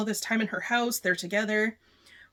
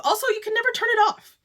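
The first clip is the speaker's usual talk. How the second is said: emphatic; 12 semitones higher, 5 dB louder, faster than usual. Real, not acted. Insists on the curse.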